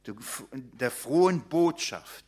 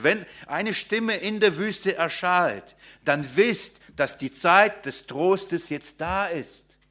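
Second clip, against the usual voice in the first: raised, ringing sermon voice, proclaiming each line; preaching; 3 semitones higher, 3 dB louder than usual. Acted. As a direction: proclaim it to the congregation with rising fervour. Preacher proclaims Isaiah passage.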